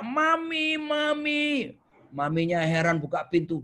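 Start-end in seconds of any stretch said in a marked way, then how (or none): 0.0-1.8 put-on high child voice calling mom; boy running home calling his mother. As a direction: call Mami like an excited kid. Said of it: "dia teriak".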